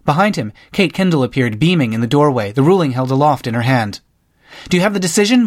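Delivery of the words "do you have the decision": raised, higher eager call; hopeful reporter-chasing shout, moderately raised; mild shout. A narrator's reading. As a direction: call out the question, eyes wide. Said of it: "Molly shouted".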